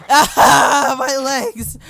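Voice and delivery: loud, high cry of pain; mock agony. Gamer voicing a maimed game character, mock agony. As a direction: wail as if badly hurt, hammed-up.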